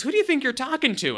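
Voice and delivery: high-pitched mock indignation; indignant dad. Dad answering his son's outburst, acted indignation. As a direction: challenge him like an affronted parent. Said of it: "and Tim goes".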